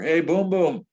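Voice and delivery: high, loud call from afar; Al Michaels yelling. Retold or imitated. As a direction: imitate someone hollering a nickname. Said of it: "it's Al Michaels yelling at me".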